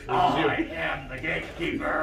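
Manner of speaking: slow, loud giant voice; booming gatekeeper. Acted. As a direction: boom it out like a guardian.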